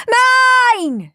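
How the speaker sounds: high, loud one-word scream. A narrator's reading. Both brothers answering Mum's question, screaming. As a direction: scream to claim it. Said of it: "screamed Henry and Peter".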